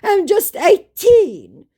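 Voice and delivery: high, loud, drawn-out "eighteen"; mock scammer. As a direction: plead youth mockingly, stretched right up. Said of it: "he said".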